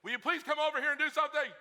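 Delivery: mock the wife scolding her husband; nagging wife. Wife nagging husband on his phone, loud and exasperated. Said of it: "is not to go".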